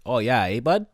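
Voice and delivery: hammed-up Canadian friendliness. Shown as a demonstration. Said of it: "we all say it".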